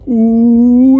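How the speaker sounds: long, high, loud taunting "oooh"; drawn-out high hoot to stir trouble; teasing "ooh". Retold or imitated. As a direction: imitate a classmate's rowdy "ooh". Buddy egging the teacher on.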